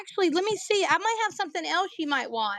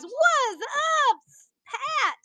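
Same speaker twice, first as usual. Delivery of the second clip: calling out; 11 semitones higher, much slower than usual. Real, not acted. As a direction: sing out a greeting to a regular walking in. Greeting a customer at the door.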